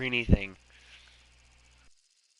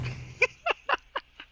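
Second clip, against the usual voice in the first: high squeaky giggle. Pretend. Laugh after squeak.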